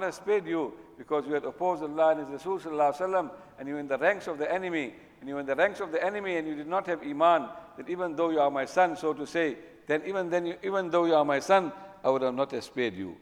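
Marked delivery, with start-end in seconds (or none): none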